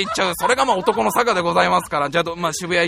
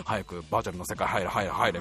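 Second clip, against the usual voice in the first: muttered urging; 13 semitones lower, 9 dB quieter than usual. Retold or imitated. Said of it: "みたいな".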